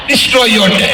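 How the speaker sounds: "destroy" hit hard at the start of "destroy your day"; punchline stress. Shown as a demonstration. Speaker finishing the slogan for students, stress on "destroy".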